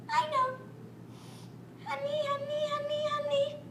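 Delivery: high hushed voice, craving the honey; high soft cub calling for honey; longing little bear. Acted.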